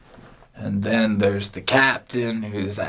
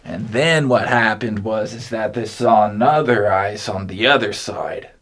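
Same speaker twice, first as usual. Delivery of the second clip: ordinary delivery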